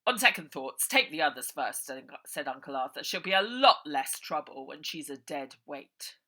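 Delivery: loud, menacing villain voice; loud, callous boss; cold villain. Acted. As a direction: give the order coldly. Villain orders coldly.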